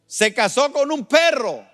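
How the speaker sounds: loud, high-pitched incredulous proclamation; full-force preaching. Acted. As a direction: announce each case like a scandal.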